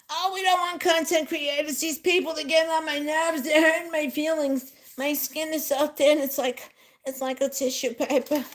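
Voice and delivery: high-pitched put-on complaining; hammed-up wounded grievance; mock upset Donald Trump. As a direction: whine in mock hurt, pitched well up. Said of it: "he's getting so angry and upset".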